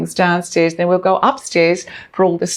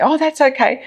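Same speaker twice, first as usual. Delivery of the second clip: high mock demurral; 10 semitones higher than usual. Clients demur, retold.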